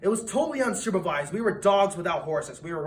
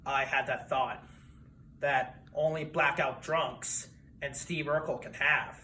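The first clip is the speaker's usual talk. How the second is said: normal talking voice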